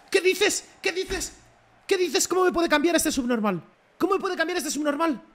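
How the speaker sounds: very high, squealed indignation; outraged disbelief. Real, not acted. Player substituted by the coach, outraged high protest. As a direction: squeal in protest at an absurd decision.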